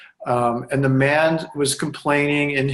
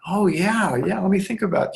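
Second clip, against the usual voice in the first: dawning realization; 5 semitones higher than usual. Retold or imitated. Client realizing mother was gone.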